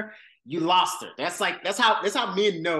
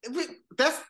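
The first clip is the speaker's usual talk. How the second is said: sudden high, stunned wait; incredulous interruption; 9 semitones higher than usual. Real, not acted. Podcaster stopping her cohost in disbelief, pitched way up.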